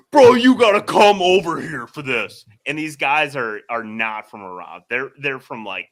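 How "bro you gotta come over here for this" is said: excited call. Retold or imitated. Movers urging him over.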